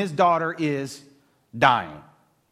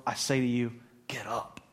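softly whispered wake-up call; whispered command; 7 semitones lower, 8 dB quieter than usual. Retold or imitated. Jesus whispering to the little girl to get up, gentle whisper. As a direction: whisper it gently as to a sleeping child.

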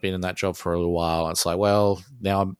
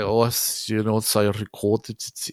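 put-on Norwegian accent; 4 dB louder than usual. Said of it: "I don't have that sweet Norwegian accent".

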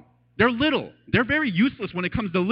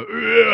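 louder wordless groan; demo groan; 3 semitones higher, 6 dB louder than usual. Shown as a demonstration. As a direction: let out a raw, wordless groan. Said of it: "I could do that for half an hour".

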